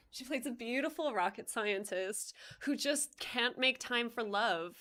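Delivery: usual speaking voice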